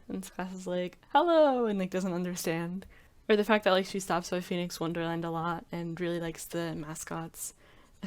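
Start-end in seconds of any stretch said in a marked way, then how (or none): 1.1-1.7 high, loud sing-song hello; booming hello. Retold or imitated. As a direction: blast a cheerful hello. Loud Tsukasa voiced.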